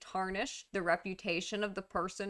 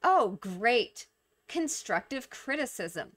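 gushing mock gratitude with exaggerated stresses; sarcastic mockery. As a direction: lay the sarcasm on thick. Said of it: "use sarcasm and mockery".

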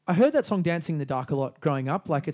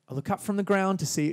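lyric line in a broad Australian accent, a bit brutal; Australian accent, harsher and plainer; 4 semitones higher than usual. Shown as a demonstration. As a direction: say it flat and blunt, Aussie style. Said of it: "be like, Australian".

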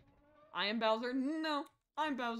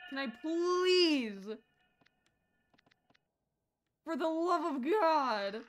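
loud, drawn-out desperate plea; raised, long-drawn cry of frustration; exasperated pleading; 4 semitones higher, 6 dB louder, much slower than usual. Real, not acted. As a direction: stretch it into a loud, slow beg. Begging the game to let them out.

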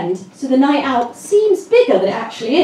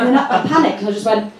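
ordinary talk

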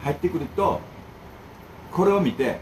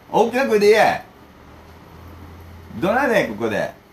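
loud and high, ordering them to speak up; loud, high call to speak louder; shouting; 9 semitones higher, 6 dB louder than usual. Real, not acted. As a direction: bark the order across the room. Calling out to someone off camera.

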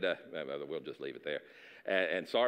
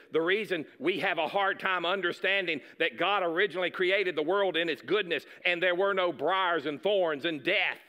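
higher, louder preaching at full force; fervent preaching; 5 semitones higher, 4 dB louder than usual. Acted. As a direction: build into a fiery pulpit climax.

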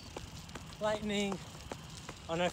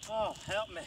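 normal talking voice

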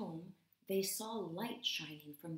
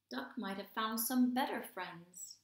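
usual speaking voice

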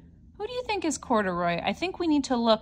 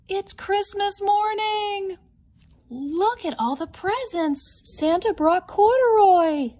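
much higher, bright delighted storytelling; excited; 8 semitones higher, 3 dB louder than usual. Acted. Showing the child Corduroy's Christmas presents, excited. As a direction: share the thrill of every present.

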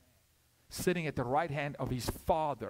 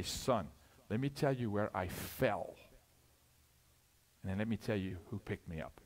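softer, lower, gentle confession to a son; father opening up to his son, quiet and lower; tender father to son; 7 semitones lower, 5 dB quieter than usual. Acted. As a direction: quietly tell your son where you fell. Father telling son softly.

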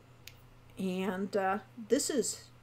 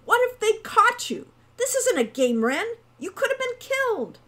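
very high, raised-voice scolding; scornful scolding; 13 semitones higher, 7 dB louder than usual. A narrator's reading. Hawk scolding Wren over the danger, high and angry. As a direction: scold in alarm, pitch soaring. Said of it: "said Hawk scornfully".

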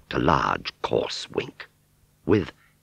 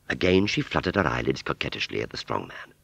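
plain reading voice

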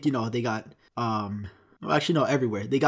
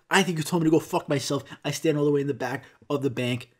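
usual speaking voice

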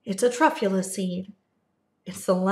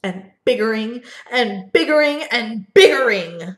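louder and louder, swelling with each biggering; building excitement; 2 semitones higher, 9 dB louder than usual. A narrator's reading. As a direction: push it up like a machine growing.

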